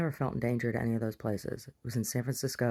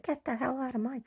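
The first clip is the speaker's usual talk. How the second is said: mock shrill owner; 12 semitones higher, 3 dB quieter than usual. Owner throws her out.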